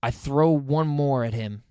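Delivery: usual speaking voice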